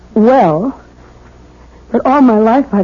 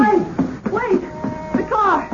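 alarmed cry; 9 semitones higher, 8 dB quieter, slower than usual. Acted. Wife suddenly cries out in alarm.

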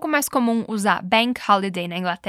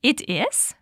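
It is? rising in mild surprise; mild surprise at the news; surprised It is?. Shown as a demonstration. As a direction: say It is? as a pleased surprised reaction. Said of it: "Então, repete comigo".

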